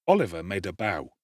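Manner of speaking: ordinary delivery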